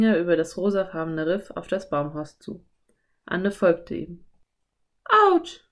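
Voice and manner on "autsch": put-on high, loud yelp in a girl's voice; sudden cry. A narrator's reading. Anne steps on something, sudden high cry. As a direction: call out suddenly, pitched high. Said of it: "rief sie plötzlich".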